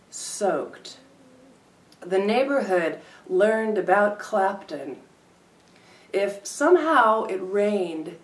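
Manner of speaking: deliberate performance reading; poetry reading. Acted. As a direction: read it out like a poet at the mic. Poem reading, part two.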